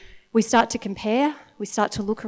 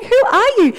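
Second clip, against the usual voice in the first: amazed cry; 10 semitones higher, 6 dB louder than usual. Retold or imitated. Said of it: "it's just like".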